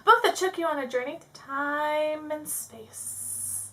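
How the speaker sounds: slow, grand dramatic read-out; drawn-out "time and space"; theatrical reading. Acted. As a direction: stretch it out like an amateur actor. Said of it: "This is why I'm not an actor".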